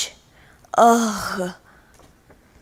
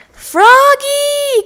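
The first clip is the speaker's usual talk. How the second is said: put-on high, loud call to a child; 14 semitones higher, 7 dB louder than usual. A narrator's reading. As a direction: stop him from afar. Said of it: "called Miss Witherspoon".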